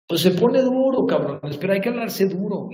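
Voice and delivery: high, loud tough-love yell; shouting. Real, not acted. Yelling at followers that life gets hard, shouting. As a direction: shout it at them to wake them up.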